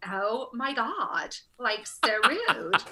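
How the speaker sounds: slow, drawn-out mock Valley-girl outrage; mock an affronted Californian, stretching every word; obnoxious Californian actress. Affronted actress after being put down, slow California drawl. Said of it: "And she was like".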